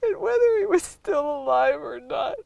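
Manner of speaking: real tearful breakdown, high strained voice; crying. Real, not acted. Witness recalling the boy left behind, crying.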